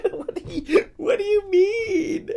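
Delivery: high, squealing laughter through the words; incredulous laughing. Real, not acted. Streamer reacting to a mean chat comment, incredulous laughing. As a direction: crack up while demanding an explanation.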